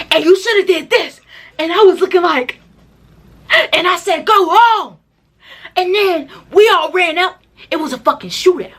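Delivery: high-pitched, hammed-up war story; mock excited retelling. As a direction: imitate someone overexcitedly retelling a fight. Mimics people who retell for hours.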